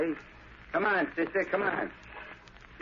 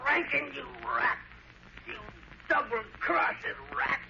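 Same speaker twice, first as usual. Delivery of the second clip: higher, pained angry voice; wounded fury; 6 semitones higher than usual. Acted. Wounded Trigger accusing Rankin, furious and hurt. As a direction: rage through the pain.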